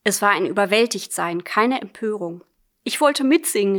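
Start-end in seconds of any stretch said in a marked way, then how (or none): 2.8-3.8 mild narrated outcry; cried out. A narrator's reading. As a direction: let it burst out, narrated not screamed. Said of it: "rief sie".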